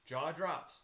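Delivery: usual speaking voice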